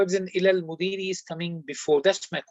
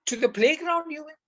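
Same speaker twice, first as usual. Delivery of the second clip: "playground" pitched much higher and stressed; emphatic fronting; 9 semitones higher than usual. Shown as a demonstration. Teacher giving a second example of emphasis, stressed high. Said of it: "in the tone in English, you can bring the tawqeed".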